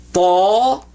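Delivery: tall with a full vowel; "tall" said clearly, drawn out; teaching tall. Shown as a demonstration. Teacher models tall for students. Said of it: "not ta".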